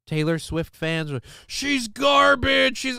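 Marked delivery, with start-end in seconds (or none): 1.4-3.0 high, drawn-out mimicked jeer; exaggerated mock outrage, stretched out; mock disdain. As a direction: imitate a hater, slow and pitched way up. Mimics people who dislike the singer.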